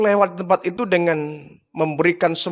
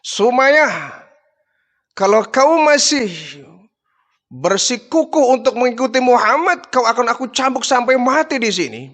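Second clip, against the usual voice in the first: threatening; 6 semitones higher than usual. Acted. Abu Jahal threatens Sumayyah with death, raised and menacing. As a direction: lean in and threaten her with the whip.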